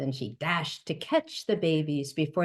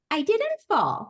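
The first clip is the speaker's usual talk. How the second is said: high, chirpy little bird voice; cheeping baby bird; 9 semitones higher, 3 dB louder than usual. A narrator's reading. Storyteller voicing the fallen baby bird, high and chirpy. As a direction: pipe it up like a tiny chick. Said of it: "the little bird cheeped".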